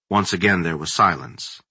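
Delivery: ordinary delivery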